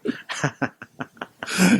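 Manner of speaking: quick high laugh; bright laugh. Real, not acted. Laughing at the host's joke.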